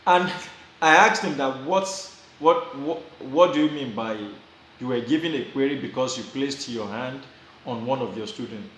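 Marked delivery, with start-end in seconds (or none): none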